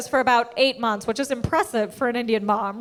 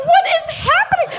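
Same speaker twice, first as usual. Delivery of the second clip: very high, loud comic shriek; panicked disbelief; 5 dB louder than usual. Retold or imitated. Teen watching the dirty set with parents, panicked shout.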